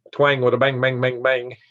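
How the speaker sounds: rhythmic, playful Southern twang. Shown as a demonstration. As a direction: bounce along in a Southern twang. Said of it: "So got the twang".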